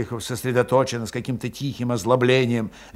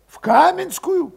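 Valet voiced high; 5 dB louder, slower than usual. A narrator's reading.

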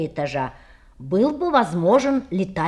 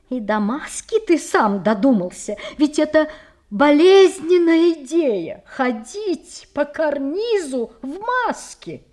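raised, louder indignant aunt; indignant questioning; 5 semitones higher, 3 dB louder than usual. A narrator's reading. Aunt scolding her nephew about walking the ledge masked, indignant. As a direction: berate the child over a crazy idea. Тётя допытывается о глупой идее.

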